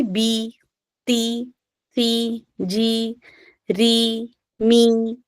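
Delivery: बी, ती, सी with a drawn-out pure "ee"; thin kasra vowel. Shown as a demonstration. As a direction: hold a thin "ee" in each, as in मी. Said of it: "एकदम बारीक हमें अदा करना". (बी से मी तक, लंबी बारीक ई)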